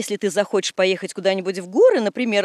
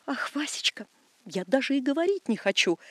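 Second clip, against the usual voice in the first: whispering; 2 semitones higher, 5 dB quieter than usual. Acted. Whispered scandal.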